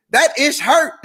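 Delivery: high, loud comic complaint; hammed-up hurt, played for laughs; mock pained realization. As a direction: blurt it high, on the edge of laughter. Jokingly voices someone hitting the wall.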